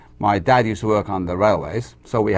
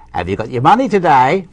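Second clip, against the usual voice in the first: lightly voiced insurance man, louder and higher; 4 semitones higher, 6 dB louder than usual. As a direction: call it out like a doorstep collector. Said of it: "insurance man used to knock on the door".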